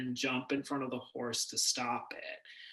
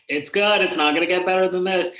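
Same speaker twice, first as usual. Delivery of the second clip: acted-out outburst, louder and higher; loud exclamation; 5 semitones higher, 11 dB louder than usual. Actor recalls telling herself on set, loud exclamation. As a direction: exclaim it loudly in a quick rush. Quoting own exclamation while making the show.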